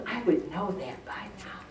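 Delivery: breathy self-mocking whisper. Real, not acted. Speaker to listeners, whispered aside about her age.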